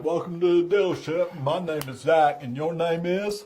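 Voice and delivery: earnest Southern simpleton greeting; Forrest Gump. Retold or imitated. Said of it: "I walk through the whole sale like that".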